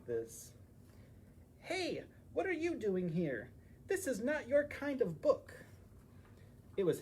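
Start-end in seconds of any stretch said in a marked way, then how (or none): 1.6-5.5 high, huffy little hedgehog scolding; indignant hedgehog. Acted. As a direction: shoo the strangers out of her book.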